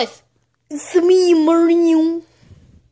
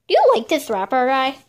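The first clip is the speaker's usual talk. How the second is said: normal talking voice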